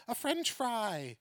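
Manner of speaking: a lightly voiced odd child; weird kid. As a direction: answer proudly like a strange little kid. Weird kid's answer.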